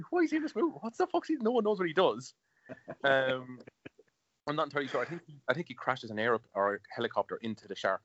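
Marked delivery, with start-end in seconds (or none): 0.1-2.3 high, bewildered disbelief; baffled. Retold or imitated. Watching Jaws sequel, baffled at Michael Caine. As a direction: squeak out the confusion like a stunned viewer. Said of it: "And I'm like".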